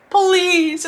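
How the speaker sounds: high, whimpering plea; hammed-up tearful begging; mock pleading. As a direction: beg half-crying, played for laughs. Mock begging amid loud singers.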